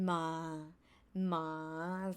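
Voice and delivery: Ma dropping low and soft; English falling ending. Shown as a demonstration. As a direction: let ma fall away. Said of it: "dropping the pitch at the end".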